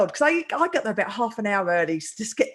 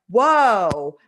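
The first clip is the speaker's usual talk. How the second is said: loud startled exclamation; 2 semitones lower, 9 dB louder than usual. Retold or imitated. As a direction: blurt it out, taken aback by a bang. Startled by banging on window.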